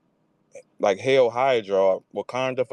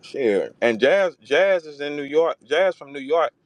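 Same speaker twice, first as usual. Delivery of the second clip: ordinary delivery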